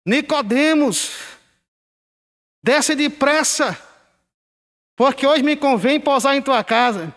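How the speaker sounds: preacher quoting Jesus. Retold or imitated. Old pastor teaching doctrine mixes up the characters, imitated drawn-out call.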